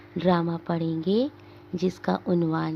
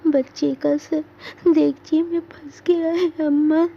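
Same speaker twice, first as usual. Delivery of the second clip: high, tearful and pleading; on the verge of crying; 8 semitones higher, 3 dB louder than usual. Acted. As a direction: daughter tells her mother in a weeping voice. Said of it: "रोनी आवाज़ में".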